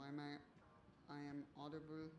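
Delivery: ordinary talk, nothing marked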